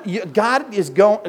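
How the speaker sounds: ordinary talk, nothing marked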